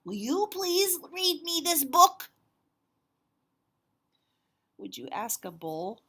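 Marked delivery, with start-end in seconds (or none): 0.0-2.3 slow, high, loud pleading; Duncan asking sweetly. Acted. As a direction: stretch out the please. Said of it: "he said to the bull".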